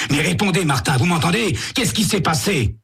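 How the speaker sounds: urgent high pleading. Acted. Sam untying the dazed Martin, urgent high pleading. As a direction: pitch up, press him for an answer.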